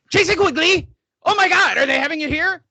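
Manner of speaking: a mock-hyped fan yelling Jason Quigley's name; Sam Navajero impression. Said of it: "taking that into his chest and going".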